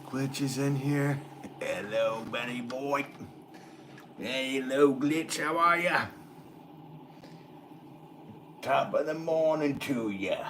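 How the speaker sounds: drawn-out, high-pitched mock Cockney; hammed-up Cockney greeting, slow and high; bad Cockney accent. Streamer greets his viewer Glitch in a hammed-up Cockney accent. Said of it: "that Cockney accent that I".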